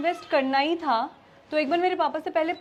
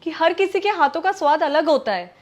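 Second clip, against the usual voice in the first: heated, raised voice; angry; 4 dB louder than usual. Acted. Wife scolds husband for comparing hands' taste, angry. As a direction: argue back forcefully. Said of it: "तुम इस बात पे इतना गुस्सा".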